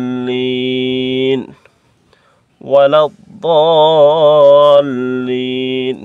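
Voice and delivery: দলিন drawn very long and stopped; slow long দলিন at the verse end; stop form dallin. Shown as a demonstration. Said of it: "ওয়াকফ করলে".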